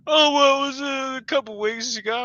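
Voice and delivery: high, loud mocking whine; mock them in a whiny voice. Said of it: "they're angry at me".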